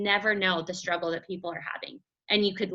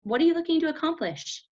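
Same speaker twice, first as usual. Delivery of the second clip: much higher, bright greeting; friendly opener; 7 semitones higher than usual. Shown as a demonstration. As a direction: sound welcoming and curious.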